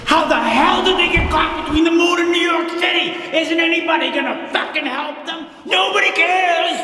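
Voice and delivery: put-on childish fury at the song; outraged kid rant. Child self outraged at lyric.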